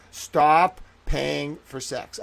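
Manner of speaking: each word slowed and weighted; deliberate, pleading stress; slow emphatic plea. Real, not acted. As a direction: stop paying for sex, spelled out slowly. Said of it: "I told you".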